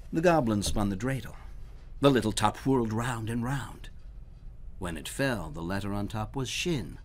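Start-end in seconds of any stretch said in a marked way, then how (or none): none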